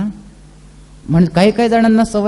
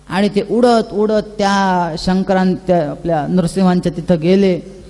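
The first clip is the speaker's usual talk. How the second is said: ordinary talk, nothing marked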